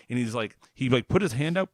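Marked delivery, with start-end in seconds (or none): none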